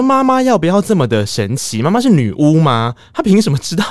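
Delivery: high and loud disbelief; incredulous outburst about mom; amazed exclaiming. Real, not acted. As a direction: marvel that mom is a witch. Amazed at mom's omniscience.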